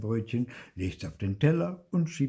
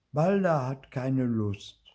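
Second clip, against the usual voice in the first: ordinary delivery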